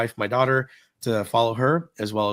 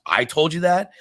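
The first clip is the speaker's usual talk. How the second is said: higher-pitched disbelieving question; incredulous retort; 7 semitones higher, 4 dB louder than usual. Retold or imitated. Cast member answers the guest's claim, incredulous. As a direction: imitate someone challenging what they just heard.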